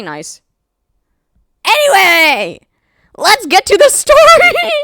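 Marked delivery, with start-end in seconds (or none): 1.6-4.7 high, loud whoop to move on; squealed rallying cry; excited shout. Real, not acted. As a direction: belt it out way up high, stretching the end. Bursting out after long character list.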